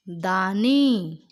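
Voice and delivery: दानी very slow and loud, final ई held long; teacher models दानी for pupils; drawn-out long ई. Shown as a demonstration. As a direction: stretch the ई at the end of दानी. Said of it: "ई करते समय इस पे ज़्यादा जोर दिया".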